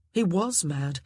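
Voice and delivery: ordinary delivery, nothing marked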